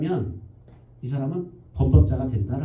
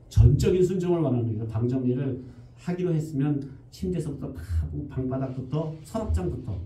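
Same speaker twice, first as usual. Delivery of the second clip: normal talking voice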